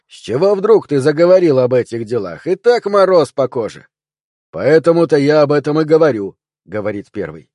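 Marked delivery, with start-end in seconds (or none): 0.1-6.4 agitated policeman. A narrator's reading. Nervous cops argue on stakeout, agitated. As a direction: argue it, jittery and loud.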